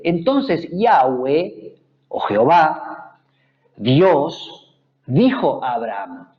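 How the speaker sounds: each word of the verse laid down slowly; slow scripture reading. Shown as a demonstration. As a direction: read the verse aloud slowly, letting each name land. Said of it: "el versículo trece de Génesis dieciocho dice". (lectura lenta y solemne del versículo)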